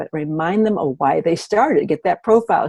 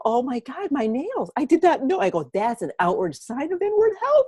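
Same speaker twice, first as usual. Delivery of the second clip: amazed; 10 semitones higher than usual. Retold or imitated. Customers' amazed reaction retold high.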